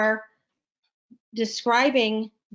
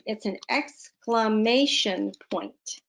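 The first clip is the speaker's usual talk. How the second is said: normal talking voice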